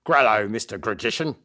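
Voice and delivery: growly words through a mouthful of teeth; lightly hammed-up monster greeting; toothy monster. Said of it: "through a mouthful of teeth".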